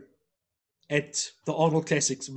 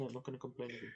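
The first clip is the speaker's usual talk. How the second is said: much lower and very soft, a defeated mutter; sheepish mumble; 6 semitones lower, 20 dB quieter, much faster than usual. Retold or imitated. Friend mocked after the prank, muttering a sheepish reply. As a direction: mumble it like a scolded kid.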